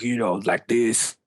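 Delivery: lightly imitated thick French accent. Coach imitating a French dude he remembers, French accent. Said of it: "this thick French accent".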